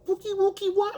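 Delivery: high mock baby talk; calling a dog. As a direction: coo a pet name as if to a dog. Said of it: "like you're calling a fucking dog".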